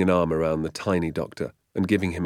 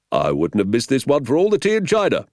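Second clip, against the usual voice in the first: higher, louder sergeant's voice; hearty police sergeant; 8 semitones higher, 9 dB louder, faster than usual. A narrator's reading. Sergeant relishing the day's adventure, hearty. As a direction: belt it out as the village sergeant.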